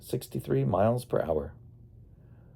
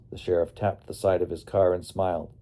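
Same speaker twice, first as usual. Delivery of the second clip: usual speaking voice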